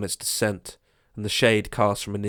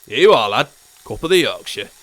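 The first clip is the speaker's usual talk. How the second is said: a lightly voiced old man calling up a ladder, louder; old man shouting up; 2 semitones higher, 8 dB louder than usual. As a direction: call up cheerfully to the lad on the ladder. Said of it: "He shouted up to Colin".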